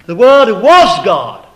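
"was" hit hard in a loud, slow "The Word was God"; stress on WAS. Retold or imitated. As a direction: lean heavily on "was", loudly and deliberately. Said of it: "when I hear them emphasize".

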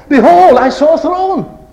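high, loud proclamation; exclaimed vision. Acted. Preacher quoting John transported to heaven, exclaimed loudly. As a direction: burst out on "Behold", ringing and high.

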